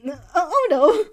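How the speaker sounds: loud, high stammered protest; flustered refusal. A narrator's reading. Blindsided by friend's flirting, flustered and loud. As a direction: blurt out a troubled, stuttering denial. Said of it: "in a genuinely troubled expression".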